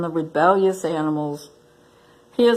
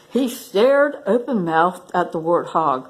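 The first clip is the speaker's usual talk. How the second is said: ordinary delivery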